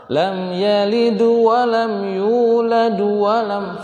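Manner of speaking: high slow chant, "yalid" and "yulad" gaining a final u; as if dhammah. Shown as a demonstration. Teacher showing how it gets misheard, "yulad" chanted as "yuladu". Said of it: "seakan-akan berharokat dom".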